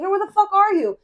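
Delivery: imitated irate demand, high and loud; angry friend. Drunk friend calling back for a pickup, angry. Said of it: "he's getting angrier and angrier".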